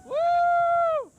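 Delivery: excited whoop. Real, not acted. Coaster launch cheer.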